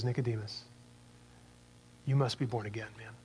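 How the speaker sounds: hushed, kindly urging; gentle quiet Jesus. Acted. Jesus urging Nicodemus, soft and low.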